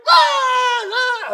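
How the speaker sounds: very high, very loud mock scream; imitated girl screaming. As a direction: project the yell so everyone knows she's being teased. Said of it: "get her to scream".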